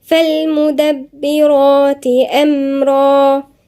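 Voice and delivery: slow, drawn-out tajweed chant; measured Quranic recitation. Shown as a demonstration. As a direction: recite it melodically with long held vowels. Recites next verse.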